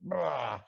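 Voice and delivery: short mock vomit noise; rough retch imitation; imitated retching. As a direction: imitate throwing up into a bucket. Imitates someone throwing up.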